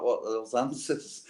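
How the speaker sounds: ordinary delivery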